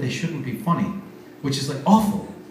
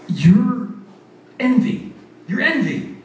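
high, delighted recognition; excited guess; 7 semitones higher, 4 dB louder, slower than usual. Retold or imitated. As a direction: announce it like you cracked it. Host guessing costume.

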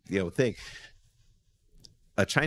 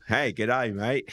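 put-on Australian accent; 3 semitones higher, 3 dB louder, much slower than usual. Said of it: "hoping you would do it in the Australian accent".

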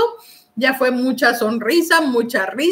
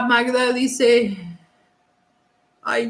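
ordinary delivery

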